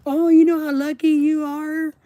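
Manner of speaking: high, loud syrupy mimicry of well-wishers; high, loud parody of sugary praise; mocking gushing voice. Mocking people calling him lucky.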